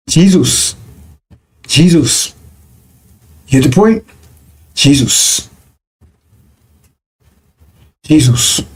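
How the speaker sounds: Jesus said as gee-ZUS, as if G were put before Zeus; Jesus sounded out like G-Zeus; G plus Zeus. Shown as a demonstration. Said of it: "if you put G in front of Zeus".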